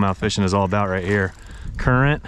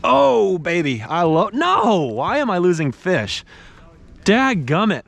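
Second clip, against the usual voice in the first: high, frustrated cry over a lost fish; really upset; 7 semitones higher, slower than usual. Real, not acted. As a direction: groan it out, upset at losing another. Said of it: "I am really upset".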